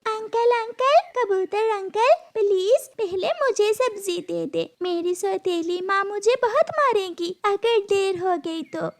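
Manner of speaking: put-on very high, anxious child voice; pleading little girl. Gudiya begs pigeon uncle to serve her first, pleading anxiously. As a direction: beg in a high girlish voice.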